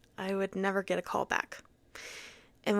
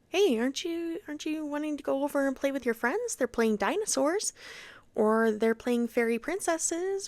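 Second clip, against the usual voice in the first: higher, sing-song preschool teacher voice; coaxing a child; 5 semitones higher than usual. Retold or imitated. Preschool aide urging a child to join friends, coaxing. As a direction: lift the pitch and coax a little one.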